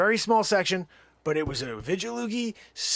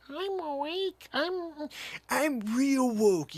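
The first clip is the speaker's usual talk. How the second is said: mock awakened believer; 12 semitones higher, much slower than usual. Newly awakened person quoted.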